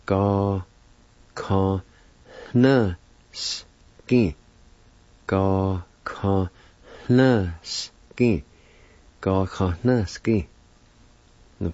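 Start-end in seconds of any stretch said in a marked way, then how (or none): 0.0-4.3 slow demo, long gaps between syllables. Shown as a demonstration. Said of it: "it's pronounced like this". 5.3-8.4 slow, separated teaching pronunciation; syllable by syllable. Shown as a demonstration. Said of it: "it's pronounced like this".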